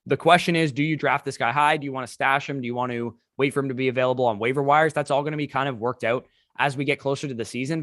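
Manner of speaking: ordinary delivery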